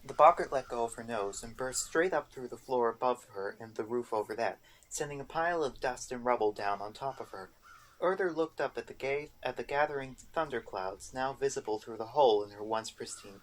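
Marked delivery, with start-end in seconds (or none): none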